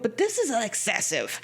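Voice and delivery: usual speaking voice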